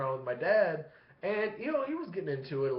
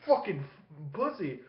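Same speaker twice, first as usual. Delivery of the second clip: higher, halting impression of dad; 3 semitones higher, slower than usual. Son acting out his dad being grabbed, sputtering. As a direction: sputter it in broken bursts, mimicking dad. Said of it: "This is my dad doing the impression".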